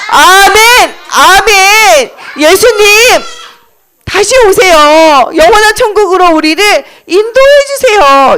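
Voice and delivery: high, fervent call to Jesus; crowd's crying out. Acted. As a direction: cry out as a congregation answering amen. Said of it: "이렇게 증거할 때 사람들이".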